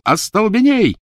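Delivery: high, loud cry of a spell; shouted spell. A narrator's reading. Boy casting a stunning spell at the werewolf, shouting. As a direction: yell the incantation out sharply. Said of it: "крикнул".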